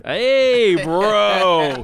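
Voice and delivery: loud, high-pitched cheer; hyped shout. Real, not acted. Co-host cheering his friend's country pick, hyped shout. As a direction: holler your approval at full volume.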